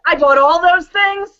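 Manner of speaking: loud, laughing admission. Acted. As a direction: laugh while confessing. Laughing at the bill.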